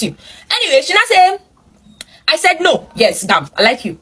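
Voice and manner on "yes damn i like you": normal talking voice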